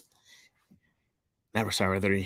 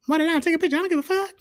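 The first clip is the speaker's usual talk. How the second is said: hyped; 4 dB louder, much faster than usual. Real, not acted. Hyped boast after quote.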